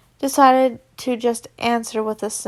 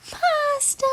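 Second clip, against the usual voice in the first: squeaky joke shout. Real, not acted. Laughs at own pasta joke.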